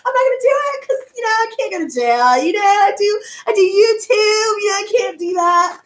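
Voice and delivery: sweet as pie while wishing harm; fake smiling politeness. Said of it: "I just try and smile".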